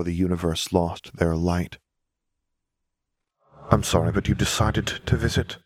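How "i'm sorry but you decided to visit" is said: a hint of a cold, flat Death. A narrator's reading. Death questioning Lily, lightly voiced and flat. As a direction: speak evenly, emotion held back. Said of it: "in a flat voice".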